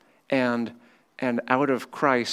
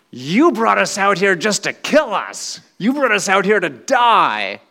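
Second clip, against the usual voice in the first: high, loud whining complaint; bitter accusation; 9 semitones higher, 8 dB louder than usual. Acted. Israelites complaining to God in the desert, loud and bitter. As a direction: hurl it at God like a betrayed crowd.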